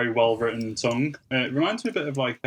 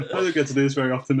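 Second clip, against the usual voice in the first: ordinary delivery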